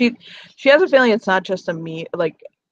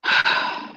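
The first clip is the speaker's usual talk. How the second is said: long audible sigh. Acted. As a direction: let out a long breath before answering. Weary in-character reply begins.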